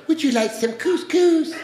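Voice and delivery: high, slow put-on mother-in-law voice. Mother-in-law offering dinner, high slow mimicking voice. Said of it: "My mother-in-law comes over and says".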